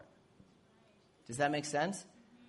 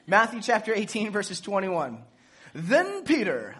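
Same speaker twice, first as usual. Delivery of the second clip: mock story time voice; 4 semitones higher, 7 dB louder than usual. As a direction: start it like a dramatic bedtime tale, then drop it. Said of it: "I'll use my story time voice".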